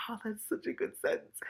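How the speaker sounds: high, unvoiced whisper of delight; whispered aside. Real, not acted. Reader admiring the writing, in a whisper. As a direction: breathe it to yourself in admiration.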